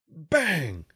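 sharp shouted bang like an impact; loud bang. A narrator's reading. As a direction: punch it out like a hit on the hull. Rock hits the ship.

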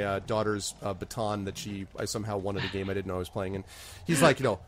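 ordinary delivery

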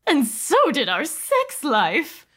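high, slow plaintive alien; pleading alien wife. Acted. Mrs Person quip.

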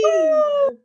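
very high, loud woo; cheering. Real, not acted. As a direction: whoop for joy.

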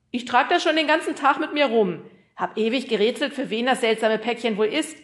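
loud, high-pitched postman's voice; chatty postman. Acted. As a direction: speak up as a talkative postman.

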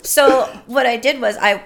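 talking through a burst of laughter; laughing. Real, not acted. Telling the host about her career, laughing through the words.

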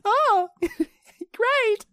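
very high falsetto greeting; hammed-up squealing mother, breaking into laughs; impression of mum. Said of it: "knows she sounds like that".

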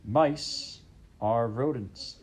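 slow stern king. Acted. King lecturing.

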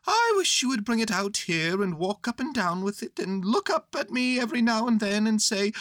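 high, earnest bear voice; Winnie the Pooh. Acted. Bear asking boy for help, high earnest voice.

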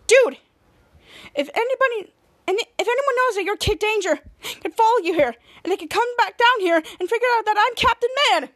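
Ray frets at Henry about being exposed; mock upset. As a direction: build a panicked rant at him.

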